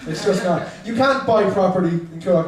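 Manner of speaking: ordinary delivery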